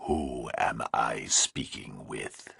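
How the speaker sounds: slow, low, menacing whisper; distorted nightmare voice. A narrator's reading. Kidnapper on the phone to Burke, slow eerie whisper. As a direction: breathe it like a monster on the line. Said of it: "the voice sounded huge and alien".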